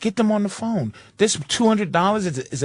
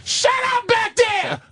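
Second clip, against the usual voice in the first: much higher, slower mock yell; mock shout; 13 semitones higher, slower than usual. Retelling a call, yelling at people behind. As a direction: yell over your shoulder at the room.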